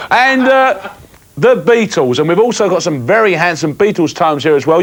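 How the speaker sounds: ordinary talk, nothing marked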